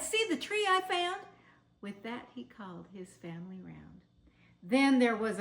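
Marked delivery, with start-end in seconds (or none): 0.0-1.2 high, loud proud call; excited cry. Acted. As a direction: show it off with a cry. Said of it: "he cried".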